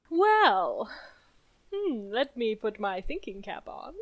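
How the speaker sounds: playful thinking-cap voice; put-on pondering voice. Player stalls before answering the host, playful pondering voice. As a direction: make a show of pondering, in a put-on voice. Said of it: "It's my favorite Jiraiya voice".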